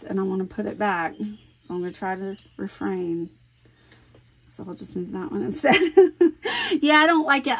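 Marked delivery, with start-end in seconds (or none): 5.8-7.6 laughing. Real, not acted. Laughs at own fussing.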